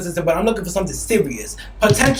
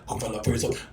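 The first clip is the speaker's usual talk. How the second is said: imitating him, lower and soft, teasing; mock sultry reply; 8 semitones lower, 10 dB quieter than usual. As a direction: drop the voice low and coy. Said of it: "that sound like on some".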